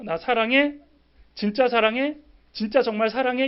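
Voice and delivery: a clingy lover demanding reassurance; insistent lover. Pretend. Lover pressing partner for love, loud and high. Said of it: "연인 관계도 그러잖아요".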